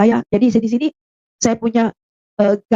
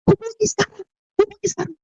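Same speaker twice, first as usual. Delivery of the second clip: higher, softer urging inner voice; 12 semitones higher, 3 dB quieter than usual. Retold or imitated. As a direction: press her quietly from within. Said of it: "di dalam hati kecil ini".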